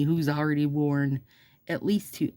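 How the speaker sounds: usual speaking voice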